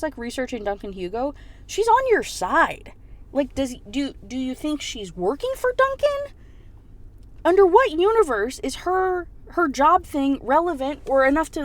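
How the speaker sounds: high-pitched, incredulous venting; exasperated rant. Real, not acted. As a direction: argue with the character in disbelief. Teller rants at the character.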